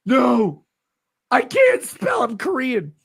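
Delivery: high shouted whine; mocking whiny voice. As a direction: shriek it high. Mocking the fan's replies.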